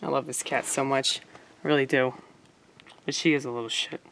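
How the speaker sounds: loving owner soothing her cat after scolding it; tender talk to the cat. Real, not acted. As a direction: coo softly to your cat. Talking softly about her cat.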